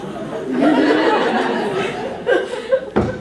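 loud rising laugh; helpless rising laughter; big laugh. Real, not acted. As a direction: burst out laughing. Laughing at the game.

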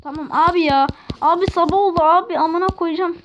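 real fed-up yelling; exasperated shout. Real, not acted. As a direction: cry out in exasperation at the game. Frustrated outburst at the game.

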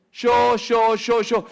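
preacher mimicking the self-absorbed; mock self-centered. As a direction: ham up someone talking only about himself. Mocking self-centered talk.

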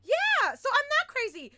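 high, loud relieved outburst; excited vindication. Real, not acted. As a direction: burst out, glad to be proven right.

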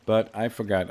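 usual speaking voice

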